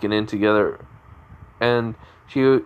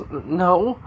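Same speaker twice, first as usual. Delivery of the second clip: stammered, higher-pitched no; acted flustered denial; 7 semitones higher than usual. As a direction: deny it as if caught out, voice lifted. Girl denying she is jealous.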